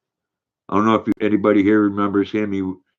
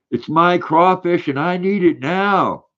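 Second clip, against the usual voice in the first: high, slow whiny ad voice; mimicking a pushy commercial plea; commercial parody; 8 semitones higher, slower than usual. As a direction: whine it like the old TV ad. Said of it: "I know that commercial".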